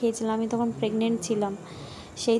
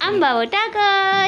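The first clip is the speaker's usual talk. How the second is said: put-on baby singsong; 9 semitones higher, 8 dB louder, slower than usual.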